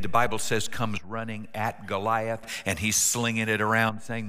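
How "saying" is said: ordinary talk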